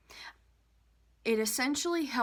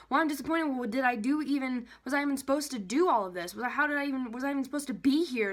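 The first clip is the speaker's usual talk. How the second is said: higher-pitched, rushed mock self-questioning; self-doubt; 4 semitones higher, faster than usual. Coach acting out the downward spiral, disappointed and doubting. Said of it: "Now I doubt myself".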